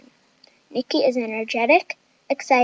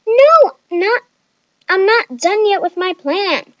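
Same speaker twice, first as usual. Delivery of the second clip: put-on high, loud babyish wail; whiny high-pitched protest; crying like a baby; 7 semitones higher, 7 dB louder, slower than usual. A narrator's reading. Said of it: "Chloe cried like a baby".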